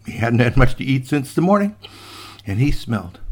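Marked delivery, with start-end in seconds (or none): none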